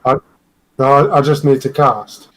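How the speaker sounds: ordinary talk, nothing marked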